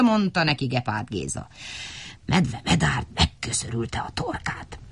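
plain reading voice

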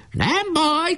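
very high-pitched defiant call; shout. A narrator's reading. Boy on the fort under bombardment, shouting. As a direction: call it out high over the fray. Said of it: "kiáltotta".

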